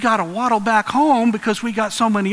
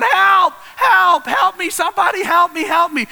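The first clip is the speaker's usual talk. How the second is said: high, loud panicked cry; screaming for help; 11 semitones higher, 4 dB louder than usual. Acted. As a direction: scream out for rescue as if hanging off a cliff. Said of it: "he starts screaming out".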